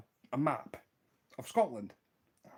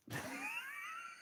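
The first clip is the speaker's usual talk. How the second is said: real high-pitched giggling fit; helpless laughter; 8 dB quieter than usual. Real, not acted. Storyteller cracking up at the friend's map mistake, helpless laughter.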